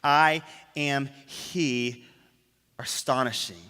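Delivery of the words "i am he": very slow, each word weighted; slow solemn quote; drawn-out emphasis. Shown as a demonstration. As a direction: stretch every word out. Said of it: "I want us to understand these three words".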